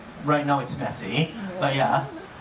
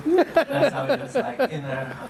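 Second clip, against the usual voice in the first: laughing; 8 semitones higher than usual. Real, not acted. Laughing after Narnia joke.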